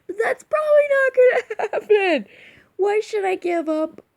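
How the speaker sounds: high, loud shriek breaking into laughter; laughing loudly. Real, not acted. Vlogger dismissing her chance of getting famous, loud laughing squeal. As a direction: crack up while nearly shouting.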